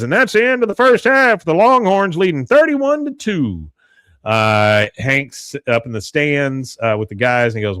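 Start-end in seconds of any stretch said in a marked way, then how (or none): 0.0-3.7 high, loud mock announcer call; stadium announcer. Announcer giving the crowd the halftime score, mock announcer voice. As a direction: imitate a game announcer, drawing out the score at the end. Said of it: "And then the announcer says".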